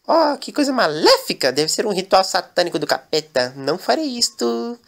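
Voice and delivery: high, scandalized voice; prim shocked maid. Acted. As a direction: clutch your pearls, mock-shocked. Daniela refuses the ritual.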